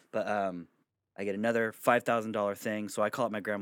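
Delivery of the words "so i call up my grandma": normal talking voice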